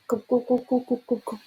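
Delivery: gulping. A narrator's reading. Drinking every drop.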